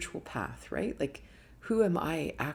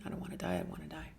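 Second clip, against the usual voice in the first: barely audible frightened plea; retold, very soft fear; fading fearful whisper; 10 dB quieter, much faster than usual. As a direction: let the fear trail to nothing. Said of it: "all these fears started coming in".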